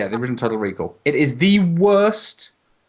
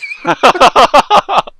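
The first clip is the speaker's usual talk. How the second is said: loud laugh; 13 semitones higher, 12 dB louder than usual. Real, not acted. Friend laughing hard at his drunk ritual. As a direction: burst of high loud laughter.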